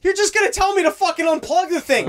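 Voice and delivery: reliving the moment he snapped at the rep, pitch shooting up; losing it. Retold or imitated. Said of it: "I've never lost it on a person more".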